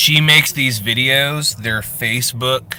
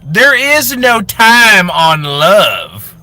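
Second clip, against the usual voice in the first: higher, louder, slow crying cry-out; dramatic wailing lover; 8 semitones higher, 7 dB louder, much slower than usual. Acted. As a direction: wail it like a soap opera heroine. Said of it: "She plays two characters".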